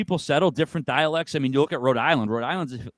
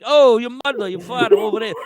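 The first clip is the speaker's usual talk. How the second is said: loud, high mock Italian-American Jersey accent; Italian-American inflection; 10 semitones higher, 9 dB louder than usual. Shown as a demonstration. Guest showing what Newfoundlanders don't say, Italian-American inflection. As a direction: exaggerate an Italian-American "Oh, your mother". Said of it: "no, like, Italian inflection on it".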